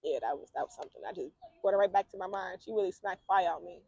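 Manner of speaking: quietly reliving the slap; subdued. Real, not acted. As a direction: sink into the memory. Remembering the smack.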